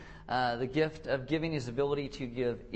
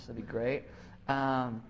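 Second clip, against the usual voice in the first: ordinary talk, nothing marked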